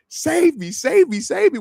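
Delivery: high-pitched mock cries for rescue; imitating helpless fans, high and rapid-fire begging; mock pleading. Player mocks fans looking for outside help, mock pleading.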